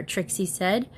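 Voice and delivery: plain reading voice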